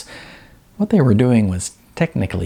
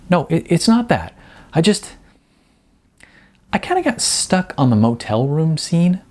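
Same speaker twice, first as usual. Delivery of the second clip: hesitant Jasmine; 8 semitones higher than usual. Acted.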